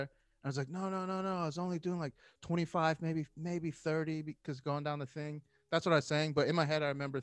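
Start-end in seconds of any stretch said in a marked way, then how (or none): 0.7-5.4 retold innocent denial, pitched up; defensive protest. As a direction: plead your case, stretching the estimate.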